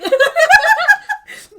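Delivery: big high burst of laughter; loud laugh. Real, not acted. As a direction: crack up loudly. Big laugh about Boston accent.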